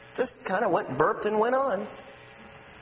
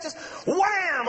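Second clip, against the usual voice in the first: sudden wham; 9 semitones higher than usual. Retold or imitated. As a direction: shout wham out suddenly, higher. Sudden explosive family reaction.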